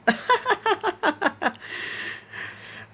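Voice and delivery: put-on very high, childlike peals of laughter; uproarious laughter. A narrator's reading. Isaac breaks the silence over the cat, laughing uproariously. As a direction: burst into high, helpless giggling. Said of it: "an uproarious laughter".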